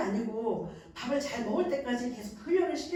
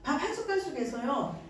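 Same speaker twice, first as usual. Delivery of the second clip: usual speaking voice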